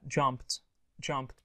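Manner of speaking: "Jumped" ending in a crisp unvoiced "pt". Shown as a demonstration. Teacher showing how to pronounce jumped, ending in T. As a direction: say "jumped" as one syllable with a final T. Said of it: "Pronounce it T".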